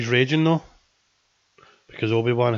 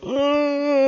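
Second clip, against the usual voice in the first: stunned gasp; 4 dB louder than usual. Retold or imitated. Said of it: "I was just like".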